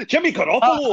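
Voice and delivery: laughing. Real, not acted. Echoing the funny name, laughing.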